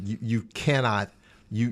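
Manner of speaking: normal talking voice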